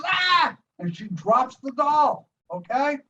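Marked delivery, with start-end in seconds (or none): 0.0-0.6 high, short mock scream; sudden high yelp of shock; startled scream. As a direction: imitate the mother's shriek of fright. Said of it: "And she goes".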